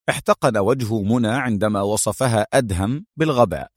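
ordinary delivery

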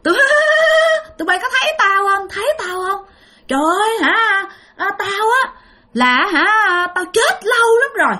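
high, eerie ghost woman's voice; a ghost mother begs four young men for milk, high and eerie. Retold or imitated. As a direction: speak high and pleading like a hungry ghost mother. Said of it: "bà nói".

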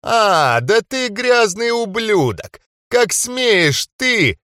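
slow, high, indignant outrage; outraged cry. A narrator's reading. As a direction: spit it out in aristocratic fury. Enraged dark mage replies.

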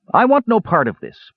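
high, emphatic refusal. A narrator's reading. Palin to his drinking brothers, emphatic. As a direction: refuse firmly, pitched well up. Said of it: "Palin said emphatically".

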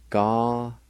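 "Ga-ne-zi" in long, separated syllables; syllable-by-syllable pronunciation. Shown as a demonstration. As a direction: hold each syllable of "Ga-ne-zi", pausing between. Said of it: "it's pronounced like this".

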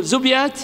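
زبيات said zubyāt, no vowel after the b; vowelless middle consonant. Shown as a demonstration. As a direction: run b straight into y in زبيات. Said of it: "إما التسكين أو الفتح". (زبيات بتسكين الباء)

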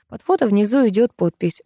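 plain reading voice

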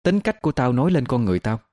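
understated cold defiance; cold retort. A narrator's reading. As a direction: answer back with icy restraint. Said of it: "lạnh lùng đáp".

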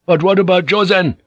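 exclaiming. A narrator's reading. Igarashi blurting his alarm to Yabu, exclaiming. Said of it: "Igarashi exclaimed".

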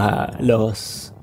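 ordinary talk

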